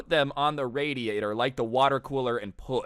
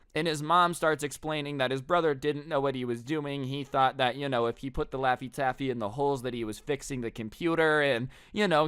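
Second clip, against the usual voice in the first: ordinary delivery, nothing marked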